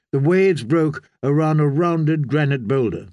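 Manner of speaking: usual speaking voice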